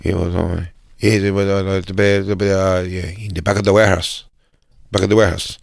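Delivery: mumbled imitation of the driver's phone talk; low-key, garbled driver impression. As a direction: mimic a driver muttering half-understood English into his phone. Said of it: "'cause he's like".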